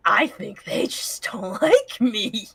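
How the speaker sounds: whispered through giggles; giggly whispered repeat; laughing whisper. Pretend. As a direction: breathe it out, laughing.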